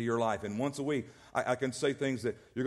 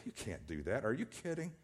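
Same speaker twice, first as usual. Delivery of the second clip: scoffing dismissal; 2 semitones lower, 8 dB quieter than usual. Retold or imitated. Preacher voicing a negative friend shooting down your faith, scoffing. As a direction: brush it off like a naysayer.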